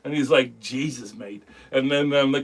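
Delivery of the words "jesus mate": imitating a reluctant friend, low and quiet; mock weary, low mutter trailing away; not having it. Friend pestered into reading a script, not having it. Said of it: "like, not having it".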